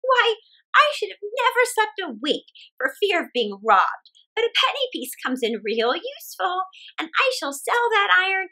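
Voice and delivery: put-on chatty old woman thinking aloud; cheerful old woman. Old woman muses over the pot in the ditch.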